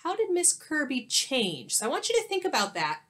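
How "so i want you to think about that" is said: plain reading voice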